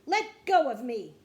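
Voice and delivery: very loud, indignant protest; angry shout. A narrator's reading. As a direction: shout it at someone grabbing you. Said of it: "she shouted".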